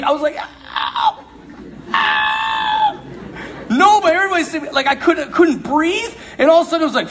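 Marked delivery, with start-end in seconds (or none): 0.4-3.0 high pained cries of ow; mock pained yelps, high and strained; retold scream of pain. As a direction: yelp it out like a stomach cramp hits. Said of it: "I was trying to scream".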